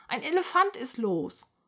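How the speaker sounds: woman crying out in alarm. A narrator's reading. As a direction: call out the alarm to everyone. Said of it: "schreit eine Frau".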